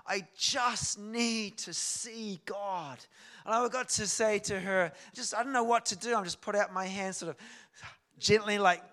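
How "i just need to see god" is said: very slow, loud imitated heart cry; imitated cry from the heart, heavily stretched; retold deep heart cry. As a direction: draw every word out in a raised plea. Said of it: "like a deep, deep heart cry".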